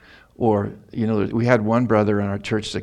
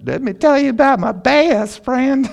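high, loud joyful exclamation; a preacher suddenly lighting up about Jesus, high and loud; excited burst; 6 dB louder than usual. Real, not acted. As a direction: burst out with delight about your best friend. Bursts out about best friend Jesus.

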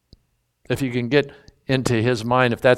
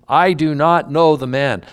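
raised, emphatic denial, not yet shouting; firm denial; 3 semitones higher, 6 dB louder than usual. Acted. Peter denying Jesus to the bystanders, firm raised denial. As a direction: push the denial out firmly with no cursing heat. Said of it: "he began to curse and swear".

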